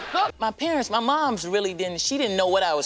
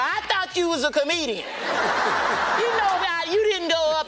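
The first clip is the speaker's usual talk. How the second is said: high, laughing mockery; mocking brothers; 10 semitones higher than usual. Brothers in the car mocking him in a high laughing voice. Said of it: "they was in the car".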